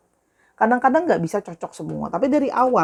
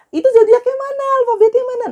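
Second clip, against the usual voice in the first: much higher, louder whiny demand; impatient viewer; 7 dB louder than usual. Retold or imitated. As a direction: play a pushy viewer who skipped ahead. Imitating impatient viewer who skipped.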